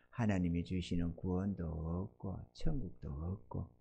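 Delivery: ordinary delivery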